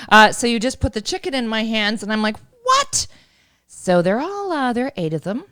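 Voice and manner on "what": high-pitched, startled question; re-enacted disbelief. City girl handed a chicken, high shocked disbelief. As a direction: squeak it in mock shock.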